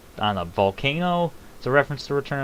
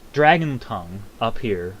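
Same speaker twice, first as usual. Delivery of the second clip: ordinary talk, nothing marked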